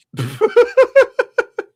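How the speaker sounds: shrieking burst of laughter; loud high cackle. Real, not acted. Bursts out at the clip.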